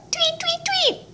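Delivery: very high, soft little tweets; bird tweets. Shown as a demonstration. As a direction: chirp like a tiny bird. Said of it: "What does a bird say?".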